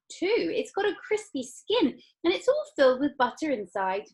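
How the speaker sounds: high, loud foodie gushing; excited Violet. Acted. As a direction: savour every dish aloud. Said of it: "shouted Violet".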